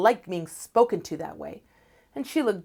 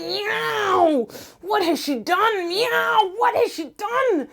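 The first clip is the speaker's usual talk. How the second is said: high, loud anguished howl; anguished cat cry; 12 semitones higher, 5 dB louder, slower than usual. Acted. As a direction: wail at what she has done. Cat sees his singed coat.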